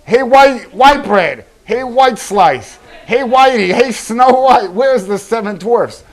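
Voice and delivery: loud, slow, playful teasing; mock a friend in a loud, slow jeer; teasing name-calling. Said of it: "and you were going".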